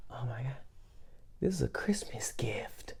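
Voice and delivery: whispering in shock over the receipt. Retold or imitated. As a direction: hush it like a secret discovery. Secretly discovering the receipt.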